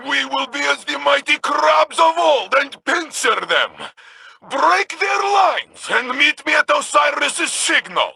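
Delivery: rousing war command; battle shout. Acted. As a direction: call the charge across the battlefield. Battle plan called across field.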